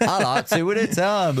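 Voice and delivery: high, squeaky put-on voice; squeaky mock voice. Friend mocking the advert in a high squeak. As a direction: pitch it way up as a silly joke.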